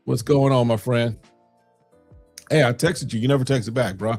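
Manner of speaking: normal talking voice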